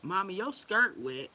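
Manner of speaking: put-on loud, drawn-out kid's tease; mock child pointing it out loudly; imitated child. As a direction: mimic a child calling out, slow and loud. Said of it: "they be like".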